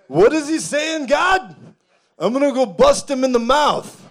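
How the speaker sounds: put-on loud, high Italian-accented tough guy. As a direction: play heaven's muscle with an Italian accent. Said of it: "a little bit of an Italian accent".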